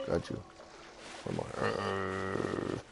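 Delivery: ordinary talk, nothing marked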